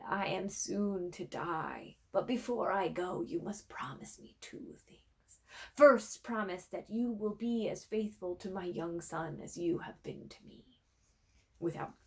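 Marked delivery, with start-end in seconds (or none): 0.1-10.8 a dying king's faint, breathy words trailing to a whisper; weak voice. Acted. Dying old king making his son's servant promise, weakly. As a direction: speak as if strength is fading. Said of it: "The king's voice was weak".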